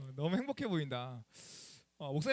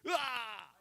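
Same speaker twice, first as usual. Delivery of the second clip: a short, high, hammed-up cry of pain; mock pained cry; 6 semitones higher, much slower than usual. Pastor reenacts slipping on the stairs, higher mock cry. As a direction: act out the fall with a big ouch. Falls at Starbucks, chest hurts.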